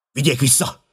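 high, urgent shout; shouted order. A narrator's reading. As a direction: call out a sharp command. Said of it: "kiáltotta".

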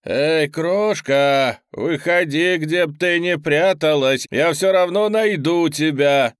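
hoarse, grim demon. A narrator's reading. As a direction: call out threateningly to someone hiding. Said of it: "Сиплый и мрачный этот голос".

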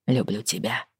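low soft "люблю тебя"; whispered love. Acted. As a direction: breathe it into her hair. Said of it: "прошептал Лео". (шёпотом признание)

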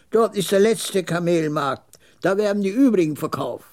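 put-on high-pitched old man explaining the route; high, matter-of-fact trader's voice; old camel trader.

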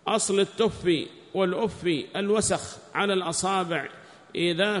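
high, loud recitation of a text; declaimed scholarly reading; reading aloud. Acted. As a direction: read it out loudly, pitched well up. Reads the book aloud.

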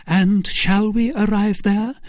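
high-pitched Milady voice; questioning Milady. Acted. Milady asking the captain for assurance, high-pitched. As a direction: ask it high, seeking assurance. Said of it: "said Milady".

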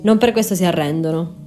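usual speaking voice

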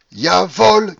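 high, loud, drawn-out cry; sudden shout. A narrator's reading. Young man provoking the baron, shouting with all his might. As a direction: belt it out, leaning on the A. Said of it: "criai-je tout à coup et de toutes mes forces".

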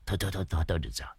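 whispering, hurrying to drop it; whispered hush. A narrator's reading. Old man hushing, brushing off his lie.

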